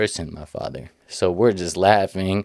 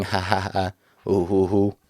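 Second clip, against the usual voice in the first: mock hearty laugh, ha ha ha ooh hoo hoo; imitated laughter. Retelling the backyard jokes, everyone laughing along in a mock laugh. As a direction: ham up the group laughing. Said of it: "we're all laughing".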